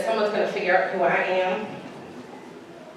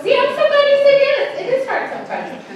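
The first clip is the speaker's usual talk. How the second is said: high, laughing delight at the answer; 4 dB louder than usual. Real, not acted. Laughing as the congregation says yes. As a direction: bubble over with a real laugh.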